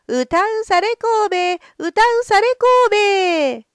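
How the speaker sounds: high, loud street cry; calling out. Acted. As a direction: call it out to the castle. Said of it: "と呼んで歩く". (呼び声)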